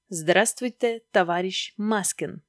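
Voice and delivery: put-on Stalin figure with a Georgian accent. Stalin greets Maskin by phone, Georgian accent. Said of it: "с грузинским акцентом засмеялась трубка".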